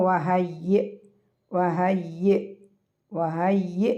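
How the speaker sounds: slow recitation of വഹയ്യ്, chopped off at the final hamza; cut-off hamza. Shown as a demonstration. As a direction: end വഹയ്യ് on an abrupt glottal catch. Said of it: "cut ചെയ്തിട്ട് pronounce ചെയ്യണം".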